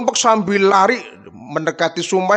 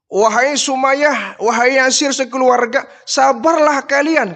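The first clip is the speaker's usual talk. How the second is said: calling out encouragement; 7 semitones higher, 3 dB louder than usual. Acted. The Prophet calls out to encourage Sumayyah, high and loud. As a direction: call to them across the desert. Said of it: "kata baginda Rasul".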